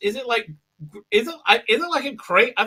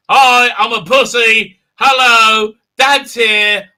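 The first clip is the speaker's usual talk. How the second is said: mock greeting; 3 semitones higher, 8 dB louder, slower than usual. Mocking Boba as a wimp.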